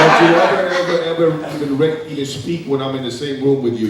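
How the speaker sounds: loud, high, menacing scolding; angry tirade. Acted. Actor threatens the young lady in the audition, angry and loud. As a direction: dare her to ever speak again. Actor berating scene partner.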